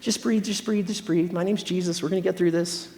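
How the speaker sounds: Jesus calming an angry God, soft and gentle; soothing, hushed Jesus. Retold or imitated. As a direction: talk him down quietly like a friend. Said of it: "Jesus comes in and he's like".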